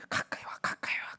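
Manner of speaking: hushed, whispered beckoning; very soft, intimate coaxing; gentle whisper. Retold or imitated. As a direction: whisper an invitation to come close. God voiced inviting people close.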